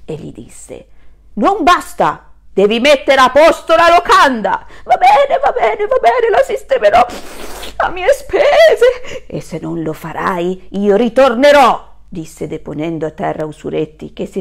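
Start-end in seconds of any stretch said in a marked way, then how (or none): 1.3-4.7 stern wolf. Acted. Wolf demands more. 4.8-9.2 very high, loud, breathless surrender, very slow at the end; panicked landlord. Acted. Landlord gives in. 9.2-11.9 louder closing threat; warning wolf. Acted. Wolf warns.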